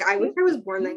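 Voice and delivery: ordinary delivery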